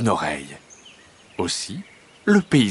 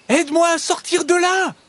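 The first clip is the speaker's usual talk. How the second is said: very high, panicked hedgehog cry; squealed high plea; crying out for help; 3 dB louder than usual. A narrator's reading. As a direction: call urgently for rescue, pitched way up. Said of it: "crie-t-il".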